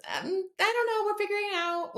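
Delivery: high, breezy fake-casual reply; awkward evasive answer. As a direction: dodge the question with a light high voice. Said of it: "I just wanted to be like".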